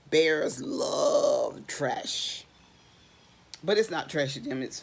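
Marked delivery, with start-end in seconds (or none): 0.1-2.4 very slow, savoring delivery; drawn out. Acted. Reading to kids, slow relishing line. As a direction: stretch each word with relish.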